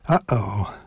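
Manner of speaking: mock worried whisper. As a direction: breathe it like a secret. Mock alarm at egotism.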